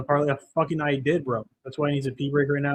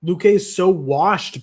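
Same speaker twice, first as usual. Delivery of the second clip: slow, higher and louder disbelief; exasperated rant; 5 semitones higher, 8 dB louder, slower than usual. Real, not acted. Streamer ranting to chat about the fighter, slow exasperated. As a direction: lean hard on every word in disbelief.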